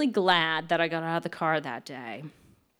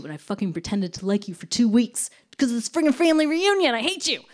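higher, faster retold rant; angry outburst; 5 semitones higher, 4 dB louder, faster than usual. Ranting at the boyfriend in the car, fast and heated. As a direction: let the fury spill out fast.

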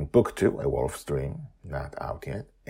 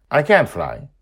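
put-on child voice; 9 semitones higher, 13 dB louder than usual. Child character quote.

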